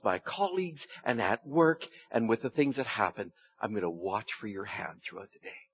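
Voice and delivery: soft, intimate prayer to God; quiet prayer. Acted. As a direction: talk to God like a friend in the car. Voices a morning prayer.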